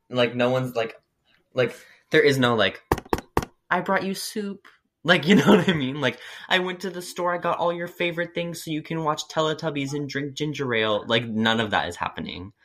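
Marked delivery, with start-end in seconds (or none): 3.7-4.7 higher, cooing like a doting parent; sweet caretaker voice. Retold or imitated. Voicing imagined caring parent. 6.5-11.0 higher, cooing like a doting parent; sweet caretaker voice. Retold or imitated. Voicing imagined caring parent.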